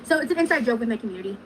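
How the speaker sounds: normal talking voice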